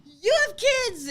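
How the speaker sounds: high, loud, sobbing panicked cry; hysterical screaming crying. Retold or imitated. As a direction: wail it like a mother panicking over her child. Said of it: "screaming, crying".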